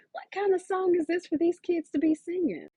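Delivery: high, loud incredulous outburst; can't believe what he's hearing; baffled disbelief. Acted. Papa hears his children's strange song, loud high disbelief. Papa's baffled inner question.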